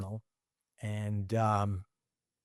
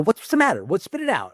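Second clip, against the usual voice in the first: pitched way up, retelling own impatient question; high, impatient quoting of himself; mock own past self; 9 semitones higher, 4 dB louder than usual. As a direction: replay your younger self, high and pushy. Retold quarrel with dad.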